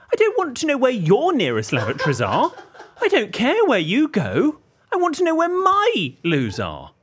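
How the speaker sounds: high, slow, exasperated complaint; leaning into every word, fed up and laughing; exasperated rant. Real, not acted. To his co-host about the missing men's loos, an exasperated high rant. Ranting about lavatory confusion.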